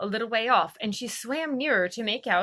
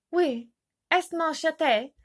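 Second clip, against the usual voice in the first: schoolgirl French; 7 semitones higher, 3 dB louder, slower than usual. A narrator's reading. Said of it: "first sentence on her French lesson book".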